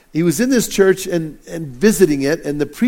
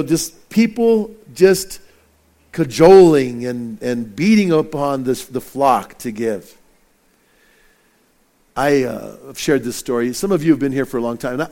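ordinary talk

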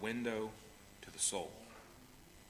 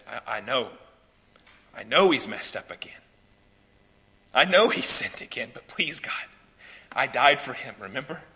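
halting plea swelling louder; pleading intercession; 7 dB louder than usual. Acted. Christ before God interceding for a sinner, earnest pleading. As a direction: plead earnestly for someone who failed.

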